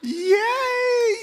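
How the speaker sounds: loud, very high drawn-out cheer; big cheer. Pretend. Teacher celebrates his student's clever answer, high loud cheer. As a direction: whoop it out in celebration.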